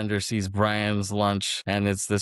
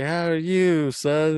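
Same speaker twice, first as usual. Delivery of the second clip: cheery fifties TV dad, higher and louder; sitcom dad voice; 7 semitones higher, 5 dB louder, slower than usual. Retold or imitated. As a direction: play a wholesome sitcom father. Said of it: "reenacts this Leave It to Beaver scene".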